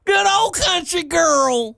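high, slow hillbilly drawl; exaggerated rural Southern voice; forced country accent. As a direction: ham up a Southern country girl. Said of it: "That's so fucking forced".

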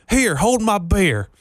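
high, drawn-out country twang. Acted. Country stereotype catchphrase, performed.

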